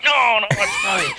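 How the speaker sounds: high, frantic mock pleading; panicked apology. Conrad apologises to the man he hit, panicked pleading. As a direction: plead like someone caught by mistake.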